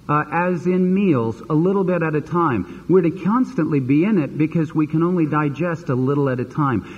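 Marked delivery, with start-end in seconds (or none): none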